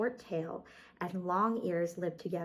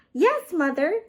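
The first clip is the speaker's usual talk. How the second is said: put-on high, louder child-bunny voice; eager little bunnies saying yes; little bunnies answering; 9 semitones higher, 9 dB louder than usual. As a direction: answer brightly like small bunnies. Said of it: "said the three little bunnies".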